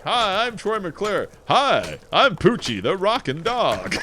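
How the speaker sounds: big, high showman's audition voice; hammed-up presenter, swooping on each name; Troy McClure. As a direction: announce yourself loud and drawn out. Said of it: "you're just doing Troy McClure's voice still".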